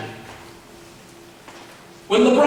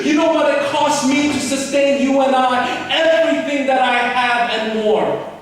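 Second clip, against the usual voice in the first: impassioned shout; 6 semitones higher than usual. Acted. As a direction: pour it out at full force, pleading. Preacher voicing God's plea.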